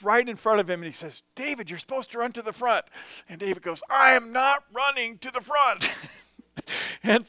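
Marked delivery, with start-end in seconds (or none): 1.3-2.9 quick, higher and pushy, nudging a lagging teammate; urging a friend. Retold or imitated. Friend telling David during the drill to sprint, quick and pushy. 3.9-5.9 higher and stubborn, flatly refusing; defiant refusal. Retold or imitated. Tired David refusing his friend, higher and stubborn.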